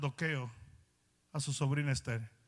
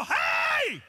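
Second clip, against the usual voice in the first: very high, loud hey; shouting again at the ignoring man; louder shout; 9 dB louder than usual. Retold or imitated. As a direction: yell it harder this time. Retold shout, second attempt.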